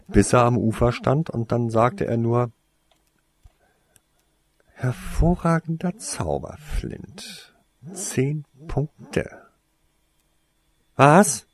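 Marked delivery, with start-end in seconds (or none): 4.7-9.4 high-pitched, measured praise; higher voice, lingering on each word; teacher's praise. A narrator's reading. Acted teacher awarding points in story. 11.0-11.5 loud, high incredulous outburst; sharp, raised-pitch astonishment; disbelief. A narrator's reading. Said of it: "konnte es Alec nicht glauben".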